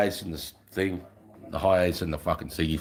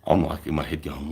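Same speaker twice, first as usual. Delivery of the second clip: ordinary talk, nothing marked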